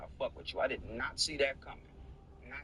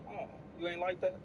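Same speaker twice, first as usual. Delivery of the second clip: ordinary talk, nothing marked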